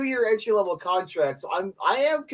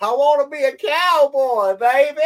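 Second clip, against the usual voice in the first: higher, louder mock cowboy accent, hammed up; fake cowboy accent; 10 semitones higher, 6 dB louder than usual. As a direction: belt it like a show-off cowboy. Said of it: "the fake cowboy accent".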